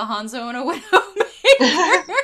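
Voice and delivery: resigned quote breaking into laughter; laughing. Retold or imitated. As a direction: laugh through the end. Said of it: "I'm like".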